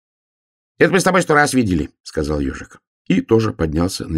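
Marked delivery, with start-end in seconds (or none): 0.8-1.9 high, rapid little hedgehog voice; loud, quick hedgehog protest; impatient hedgehog. A narrator's reading. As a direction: rattle it off dismissively, pitched up. Impatient hedgehog answers bear.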